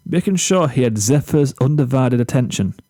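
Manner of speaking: usual speaking voice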